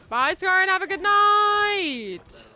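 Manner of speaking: loud taunting goodbye. Real, not acted. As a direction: belt a mocking goodnight. Taunting a defeated enemy.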